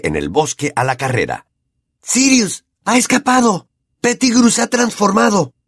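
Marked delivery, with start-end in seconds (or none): 2.0-5.5 high, urgent shout to a friend; shouted warning. A narrator's reading. Harry shouting to Hermione, urgent high cry. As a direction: call out in alarm. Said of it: "gritó Harry".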